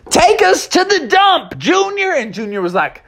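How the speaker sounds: high excited shout; excited cry. Acted. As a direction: call it out like a charge. Heroes charge to dump.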